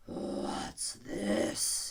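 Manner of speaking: sleepy bell-like lion query; deep hollow lion. A narrator's reading. Lion blinking at Alice, deep and hollow. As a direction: toll it out like a great bell. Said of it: "in a deep, hollow tone".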